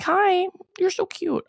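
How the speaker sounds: put-on very high, slow, cooing as if to a toddler; cooing baby talk. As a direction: coo down at him like he's a little kid. Leaning over, cooing at Paul.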